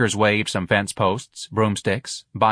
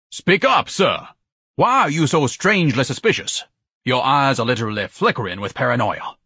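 overbearing, accusing pomposity; haughty dressing-down, starting high and loud; pompous Ignatius; 4 semitones higher, 3 dB louder than usual. Acted. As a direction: demand answers imperiously. Voices Ignatius.

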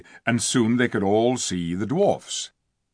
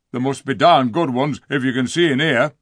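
rough, louder dwarf voice; surly dwarf Diggle; 3 semitones higher, 6 dB louder than usual. Acted. Diggle mocking the children in the dark, rough and scornful. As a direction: sneer like a grumpy dwarf.

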